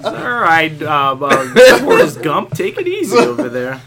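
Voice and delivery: loud teasing through laughter; laughing tease. Real, not acted. Co-host likens friend to Forrest Gump, laughing tease. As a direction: rib a friend while still laughing.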